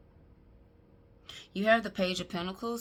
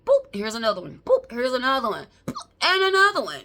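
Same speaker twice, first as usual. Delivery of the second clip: "boop" popped out louder and higher each time, last one much higher; mock baby-popping build-up; 5 semitones higher, 11 dB louder than usual. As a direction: build it up like babies popping out one after another, ending high and loud. Mocking babies coming back to back.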